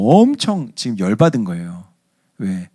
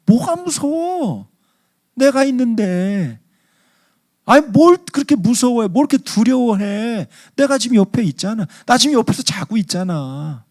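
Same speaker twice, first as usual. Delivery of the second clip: chiding reassurance; 11 semitones higher than usual. Acted. Jesus to scared disciples, exasperated parental chiding. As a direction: chide them like a parent, voice soaring. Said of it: "제자들을 꾸짖는 주님의 마음".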